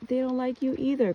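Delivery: put-on high, insistent child voice; high-pitched little-kid protest; little girl's plea. Child's plea acted out in dream.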